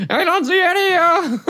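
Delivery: very high, loud, helpless wail; flustered droid navigator. Acted. Mira scanning and finding nothing, high flustered voice. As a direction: report failure in a high, flustered voice.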